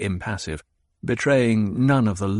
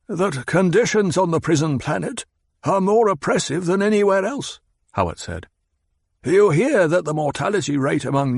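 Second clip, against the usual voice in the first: higher, pressing argument; Hawat, insistent; 8 semitones higher than usual. Acted. Hawat lectures the Baron on the prison planet, insistent. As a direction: drive the point home.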